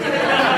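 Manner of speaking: quick real laugh; short laugh. Real, not acted. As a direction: burst out laughing. Laughs at the delivery girl.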